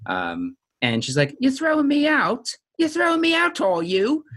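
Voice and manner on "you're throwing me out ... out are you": put-on high Carolyn accent, pitched way up. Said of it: "the famous Carolyn accent".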